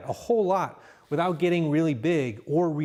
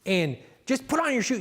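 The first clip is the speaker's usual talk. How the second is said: mock yell; 7 semitones higher than usual. Shown as a demonstration. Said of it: "yelling from over here".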